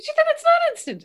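very high, loud mock indignation; retold anger. Dad challenging daughter on Instagram, high and indignant. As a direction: accuse in a high, put-out voice. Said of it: "so angry".

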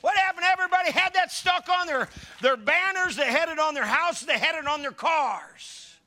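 high, loud pulpit preaching; fervent preaching. Acted. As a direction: lift it up like a revival preacher. Preaching at full force.

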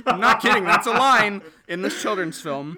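breaking into whispered laughter mid-sentence; giggling so hard the words go breathless and loud; laughing. Real, not acted. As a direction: laugh through the words in disbelief. Laughing at absurd film line.

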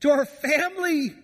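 high, slow, crying plea of a frantic mother; distraught mom crying out. Acted. Mother crying out to her teenage son, high anguished wail. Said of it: "it's the mom crying out".

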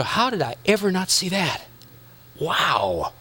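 hushed, dropping into awe; a soft discovery, stunned; quiet wonder. Retold or imitated. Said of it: "all of a sudden you go".

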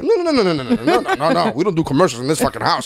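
high, rapid-fire string of noes settling down; comic outraged protest breaking into laughter; mock frantic refusal. Movie fan refusing to sit through commercials, mock frantic.

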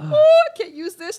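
high, loud, slow whiny lament; hammed-up woe over a gift; mock lament. Opening Christmas presents after failing, a whiny mock lament.

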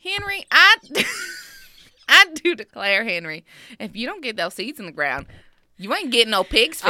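Southern belle scolding Henry; country accent. Acted.

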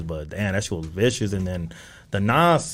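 ordinary delivery

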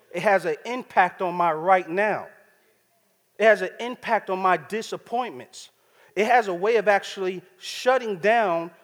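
building preacher emphasis. Real, not acted. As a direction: press "has" hard and raise the voice. Preacher repeats the point, building up.

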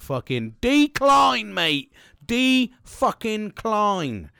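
loud, high, drawn-out angry refusal; passionate outburst. Retold or imitated. Upset worker retelling his refusal of a meeting, passionate outburst. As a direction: stretch each word out loud with real indignation. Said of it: "you can feel the passion in my voice".

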